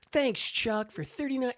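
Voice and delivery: high sing-song greeting-card voice; cheery. Retold or imitated. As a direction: chirp it out, mock cheerful. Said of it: "has that kinda cheery".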